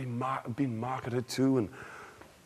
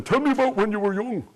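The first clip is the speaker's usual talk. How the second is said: higher, bright interviewer prompt; storyteller asking the elderly a question, higher; prompting elders; 8 semitones higher, 4 dB louder than usual. Shown as a demonstration. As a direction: ask it like an eager visitor. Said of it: "Instead of saying".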